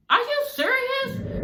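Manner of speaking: high, drawn-out incredulous question; stunned disbelief. Real, not acted. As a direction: stretch it out way up high, scandalized. Scoffing at his God excuse.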